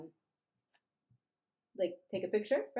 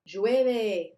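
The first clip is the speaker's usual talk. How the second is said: loud model word; 2 semitones higher, 11 dB louder than usual. Shown as a demonstration. Teacher modeling weather word.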